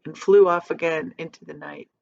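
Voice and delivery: plain reading voice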